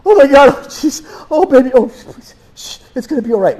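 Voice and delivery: high, loud frantic pleas easing into quick shushing; beg, then calm the baby; panicked pleading mother. Pretend. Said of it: "you should be okay".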